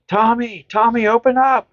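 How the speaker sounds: high, pleading cry for Tommy; desperate call. Retold or imitated. Woman pounding on the door, calling Tommy. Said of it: "And she's like".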